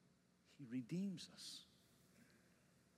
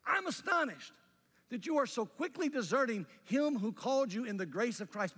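much higher and louder, outraged; astonished rant; 6 semitones higher, 9 dB louder than usual. Retold or imitated. Pastor reading Paul's holy rant, loud astonished outrage. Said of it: "look at what he says".

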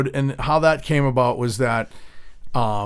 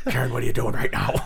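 rapid put-on character voice; Bat Dad; 2 semitones lower, faster than usual. Comic dad character questioning Karen, fast impression. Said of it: "Bat Dad fucking rules".

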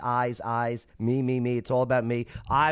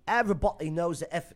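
higher-pitched attempt at Australian, sounding British; Australian turned British; 6 semitones higher than usual. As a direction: miss Australian and land in British. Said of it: "No, that's more British".

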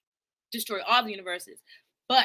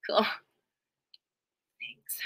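ordinary talk

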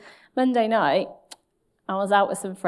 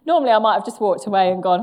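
ordinary talk, nothing marked

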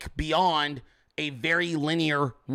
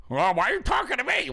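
higher-pitched mocking imitation of a commenter; mock complaint; 6 semitones higher than usual. Streamer parrots a chat commenter's complaint, high and mocking. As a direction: mimic an indignant complainer, pitched up.

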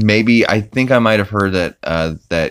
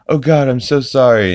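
a bit higher and louder, still sorry; imitating Peter apologizing; 7 semitones higher, 4 dB louder than usual. Dad repeating his apology, a little louder. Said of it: "he's like".

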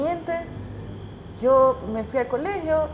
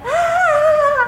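sudden high wail of shock; a high crying ah, stuck at the wheel; retold crying wail; 7 dB louder than usual. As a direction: let out a high panicked sob. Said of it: "Y llorando".

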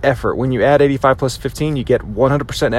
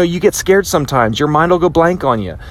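ordinary talk, nothing marked

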